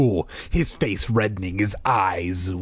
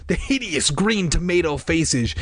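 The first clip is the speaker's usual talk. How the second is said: ordinary delivery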